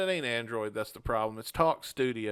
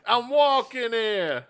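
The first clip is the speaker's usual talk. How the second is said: put-on slow, loud, high New York Italian accent; mock New York accent; 9 semitones higher, 5 dB louder, slower than usual. Repeating the walking-here joke for his friend in a hammed-up New York accent.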